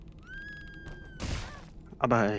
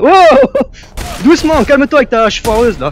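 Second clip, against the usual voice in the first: loud, high startled cry then mock scolding; startled outburst; 9 semitones higher, 10 dB louder than usual. Real, not acted. Startled by the enemy's axe, loud high scolding. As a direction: jump back and scold the axe-wielder.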